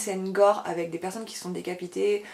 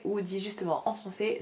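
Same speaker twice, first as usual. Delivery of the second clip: ordinary talk, nothing marked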